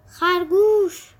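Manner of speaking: slow, high, drawn-out frog voice; croaking frog call. Acted. Frogs call out to Thumper. (صدای قورباغه، کشیده و آهسته)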